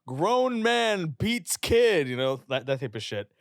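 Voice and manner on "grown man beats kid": high, loud and very slow mock headline; mock tabloid news headline. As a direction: spell out the headline like a big news banner. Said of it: "they didn't say, like".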